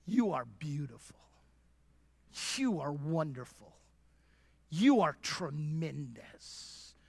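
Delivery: soft slow whisper of loving reassurance; whispered voice of God. Pretend. Pastor voicing God softly to the women. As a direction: breathe each line gently, slowly. God speaking tenderly to listeners.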